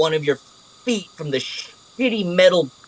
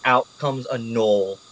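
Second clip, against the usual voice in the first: ordinary talk, nothing marked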